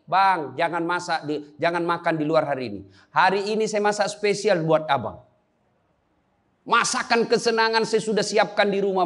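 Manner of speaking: sweet-talking your husband, lightly voiced; sweet wife's message.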